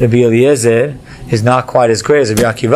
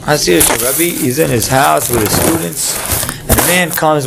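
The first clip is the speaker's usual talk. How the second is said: usual speaking voice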